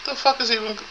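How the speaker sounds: high, baffled squawk; baffled outburst. Retold or imitated. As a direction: blurt it out in disbelief. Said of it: "I'm like".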